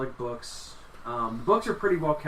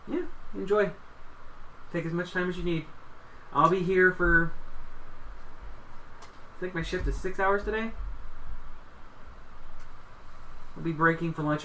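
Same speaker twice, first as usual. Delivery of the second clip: a touch higher, unhurried librarian voice; lightly voiced librarian; 4 semitones higher than usual. Librarian telling visitors about her shift and colleague, lightly voiced. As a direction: play a helpful librarian with halting pauses.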